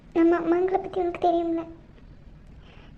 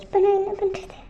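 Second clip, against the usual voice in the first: normal talking voice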